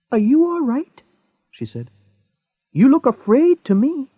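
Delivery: a hint of a caring older woman; woman checking on the frightened young man; concerned woman. A narrator's reading. Woman voiced in story.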